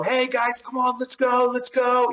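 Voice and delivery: high, pumped-up rallying voice; high, lightly hammed rally call; mock eager leader. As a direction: hype the team up, playfully. Imitating eager team leader.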